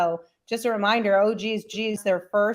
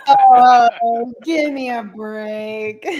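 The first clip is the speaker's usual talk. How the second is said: exasperated groan; 2 semitones higher, 8 dB louder, much slower than usual. Real, not acted. Repeats exasperated protest, laughing after.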